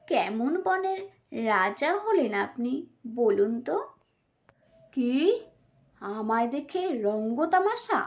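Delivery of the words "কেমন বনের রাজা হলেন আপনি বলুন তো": usual speaking voice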